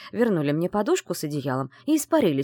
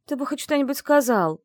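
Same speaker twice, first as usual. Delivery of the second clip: high, timid squeak; 8 semitones higher than usual. Acted. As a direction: squeak it out nervously. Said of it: "трусливо пропищала я".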